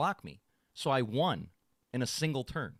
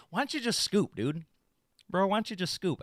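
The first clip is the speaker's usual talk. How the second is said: taunting; 6 semitones higher than usual. Retold or imitated. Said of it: "he kept telling me".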